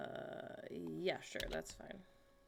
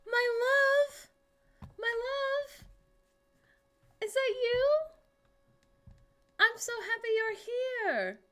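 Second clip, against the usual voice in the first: much higher, loud swooning voice; gushing lover; 7 dB louder than usual. Acted. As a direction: cry it out like a lovestruck heroine. Gushing greeting in a put-on voice.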